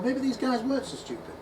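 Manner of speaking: ordinary talk, nothing marked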